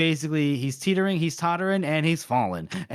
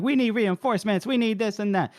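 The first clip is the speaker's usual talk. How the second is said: higher-pitched mock urgent plea; urgent messengers; 7 semitones higher than usual. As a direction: imitate pestering messengers, pitched up.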